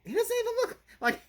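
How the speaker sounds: high, squeaky mock disbelief; hammed-up high-pitched bafflement; incredulous protest. Acting out own disbelieving reaction.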